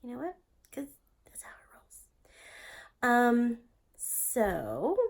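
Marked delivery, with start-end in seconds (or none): 0.7-2.0 whispering. Real, not acted.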